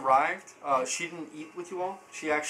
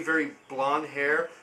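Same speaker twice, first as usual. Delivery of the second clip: ordinary talk, nothing marked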